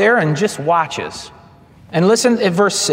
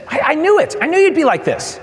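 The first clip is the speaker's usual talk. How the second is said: high exasperated complaint, pitched up; whiny Jonah; 9 semitones higher, faster than usual. Retold or imitated. Preacher voicing Jonah's exasperated complaint to God.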